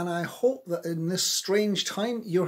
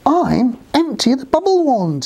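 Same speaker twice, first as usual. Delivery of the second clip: higher, projected self-introduction; mildly raised, calling character voice; loud cry; 7 semitones higher, 8 dB louder than usual. Acted. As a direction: announce yourself to whoever is out there. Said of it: "he cried loudly".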